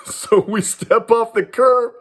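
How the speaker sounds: high and laughing through the words; can't hold back a laugh as he tells it. Real, not acted. Telling a friend the story, laughing at the memory. Laughs while retelling the street scene.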